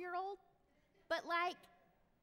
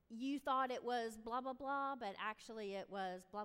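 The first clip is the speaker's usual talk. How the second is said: ordinary talk, nothing marked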